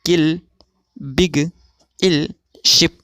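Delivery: किल, बिग, इल, शिप said with a short i; pronunciation examples. Shown as a demonstration. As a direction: model each word carefully. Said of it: "मिसालें देखते हैं".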